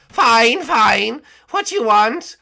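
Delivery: high, loud whiny servant voice; whiny guard. Acted. Woken by his captain, a high loud whiny guard. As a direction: whine up high at being woken.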